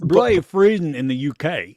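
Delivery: loud, hammed-up British accent. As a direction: read it out in a mock British accent. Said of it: "say the previous message in your best British accent".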